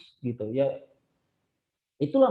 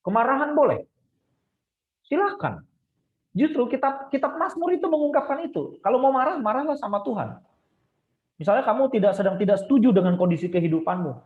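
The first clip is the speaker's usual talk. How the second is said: raised, higher preaching push; 8 semitones higher, 4 dB louder than usual. Acted. Preacher urging listeners to cry out to God, raised and higher. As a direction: urge the congregation to bring anger to God.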